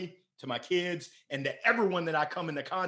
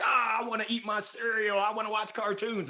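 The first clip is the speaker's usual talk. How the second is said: put-on higher-pitched childish protest; whining kid; 6 semitones higher than usual. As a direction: whine like a kid kept from cartoons.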